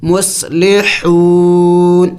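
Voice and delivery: مصلحون slow, final نون silent yet heard; مصلحون drawn out, ending in a light ن; stopping on the nun. Shown as a demonstration. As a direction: stretch مصلحون, close on a sounded نون. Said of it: "لیکن نون کی آواز نکلنی چاہیے".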